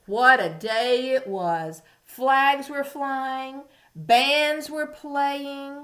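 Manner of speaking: slow, festive storybook voice; reading to a toddler. A narrator's reading. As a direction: savor each phrase for little ones. Reading story to young children.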